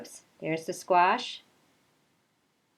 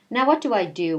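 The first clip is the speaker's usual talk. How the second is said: louder, higher fretting cry; a flustered gardener at her wits' end; fretful Nellie; 4 semitones higher, 6 dB louder than usual. Acted. Nellie asks herself in alarm, louder and higher.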